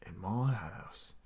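low, very soft, slow rasp; murmured low and drawn out; gritty voice. Shown as a demonstration. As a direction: test a hushed gritty pirate voice. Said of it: "What else do I have with grit?".

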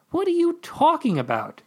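high incredulous question; indignant. A narrator's reading. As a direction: sound baffled and offended.

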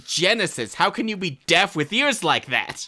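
McCoy retort. Retold or imitated. Quoting McCoy mocking a deaf colleague, exasperated. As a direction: bark it like a grumpy doctor. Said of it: "the McCoy scene".

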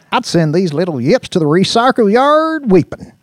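loud, high swaggering threat; gleeful Brick. Acted. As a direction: boast loudly, stretching it with relish. Brick boasts with relish.

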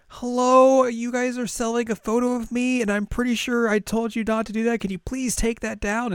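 put-on high, light girlish voice of Kotori. Kotori scolds the girls over her photo in a high voice. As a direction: play her sweet but firm. Kotori shows up in a maid costume.

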